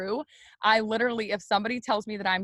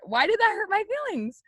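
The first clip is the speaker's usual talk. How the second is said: very high-pitched hammed-up complaint; mock wounded bewilderment; 12 semitones higher than usual. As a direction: send the question way up, playfully baffled. Exaggerates her baffled hurt.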